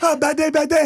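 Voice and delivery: high, loud comic action-hero cry; Jackie Chan. Acted. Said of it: "playing young Jackie Chan or something".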